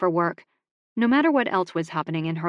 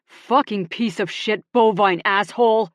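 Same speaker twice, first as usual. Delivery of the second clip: louder furious curse at the steer; angry yell; 3 semitones higher, 7 dB louder than usual. Acted. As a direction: bellow it out in pain and rage. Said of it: "and yelled".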